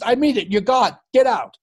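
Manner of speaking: high, loud stern order; mock ejection. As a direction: throw him out like a fed-up umpire. Umpire ejects manager.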